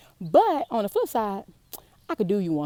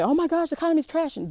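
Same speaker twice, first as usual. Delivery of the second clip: mock panic; 9 semitones higher, faster than usual. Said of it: "When people say".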